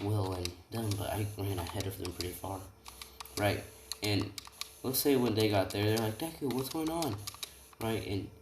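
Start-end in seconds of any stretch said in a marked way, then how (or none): 6.2-7.2 puzzled questioning. Retold or imitated. Friends arrive and question Deku.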